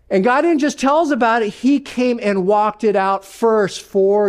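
fervent sermon push. Real, not acted. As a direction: drive the point home quick. Preacher pressing the point.

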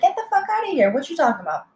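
high, quick retold outburst; incredulous outrage. Waitress confronting customer over stolen cash, incredulous. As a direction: scoff it out in mock outrage, pitch leaping up.

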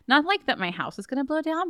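ordinary talk

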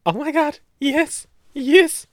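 high, loud excited squeal; re-enacted squeal of joy; retold delight. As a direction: act out a thrilled fan moment. Retells delight at being recognized.

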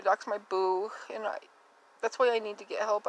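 usual speaking voice

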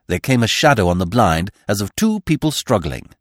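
ordinary delivery

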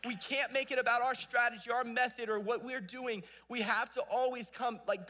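high, loud pulpit preaching; full-force preaching. Acted. Pastor urging church to make it about Jesus, full-force preaching. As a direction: preach it out, pressing hard.

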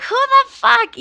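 high-pitched, loud disbelief; incredulous outburst. Retold or imitated. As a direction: burst out indignantly, pitch leaping way up. Reacting to the woman's hello.

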